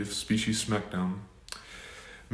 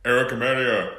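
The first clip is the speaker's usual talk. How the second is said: boxing-match fighter introduction; ring announcer. Acted.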